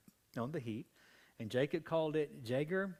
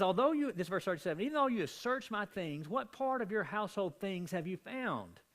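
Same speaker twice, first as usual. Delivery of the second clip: indignant; 6 semitones higher than usual. Retold or imitated. Jacob confronting Laban.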